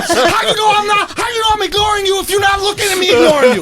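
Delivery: high-pitched, shouted comic rant; furious outburst. Acted. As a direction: blow up at her, yelling way up high. Acted rant at his girlfriend.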